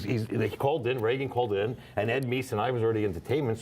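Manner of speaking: normal talking voice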